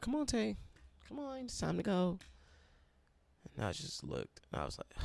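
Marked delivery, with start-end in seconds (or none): 0.0-2.2 much higher voice for a friend, a little softer; imitating a friend. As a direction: lift into a higher pitch to mimic her gentle nudge. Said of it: "she was like".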